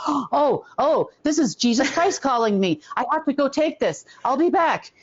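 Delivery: high, mock-surprised excuse; pretend urgent call. Faking a call to escape a meeting, high pretend surprise. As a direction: fake surprise at an important phone call. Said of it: "pretend like".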